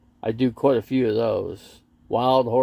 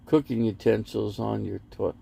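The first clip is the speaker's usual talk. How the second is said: ordinary talk, nothing marked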